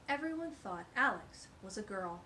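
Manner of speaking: plain reading voice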